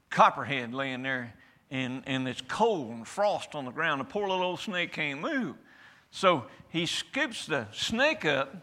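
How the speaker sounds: ordinary talk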